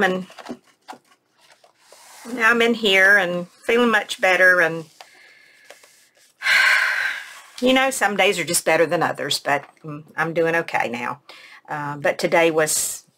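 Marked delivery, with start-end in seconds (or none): 6.4-7.2 long unvoiced breath out; sigh. Real, not acted. Narrator recounting a hard day, sighing. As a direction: let out a real, audible sigh.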